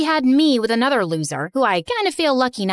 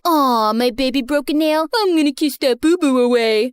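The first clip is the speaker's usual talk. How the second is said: lightly voiced cooing boyfriend, a touch mocking; imitating a couple's baby talk. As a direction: coo it like a sappy guy to his girlfriend. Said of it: "started baby talking in my face".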